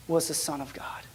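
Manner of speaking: sermon climax dropped to a whisper; dramatic whisper. Real, not acted. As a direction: whisper it for weight. Preacher drops to whisper for emphasis.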